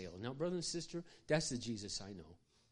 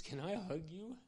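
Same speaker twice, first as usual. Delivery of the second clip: sobbing request; 7 semitones higher than usual. Retold or imitated. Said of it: "he started to sob".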